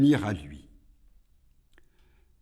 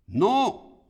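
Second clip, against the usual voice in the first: high-pitched demon's no; insistent, raised-pitch refusal; 12 semitones higher, 7 dB louder than usual. A narrator's reading. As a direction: throw the word back high as Iblis. Iblis answers God.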